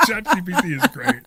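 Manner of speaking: talking through laughter; laughing. Real, not acted. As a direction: still laughing while speaking. Laughing at script joke.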